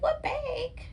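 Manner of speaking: a coy squeak pretending not to know; playful high squeak. Playing innocent about the bag to viewers, in a high squeak. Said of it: "I keep looking over there like".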